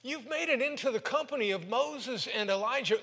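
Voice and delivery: "company of Moses and Elijah" pitched high and loud; thrilled and starstruck, voice lifted high; excited blurt. Retold or imitated. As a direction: gush it like a starstruck fan. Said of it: "Peter blurts out".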